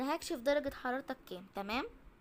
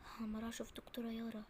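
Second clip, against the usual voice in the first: hushed unvoiced whisper at the crowd; 10 dB quieter than usual. Nurse whispering in shock at the crowded clinic. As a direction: whisper it so the patients can't hear.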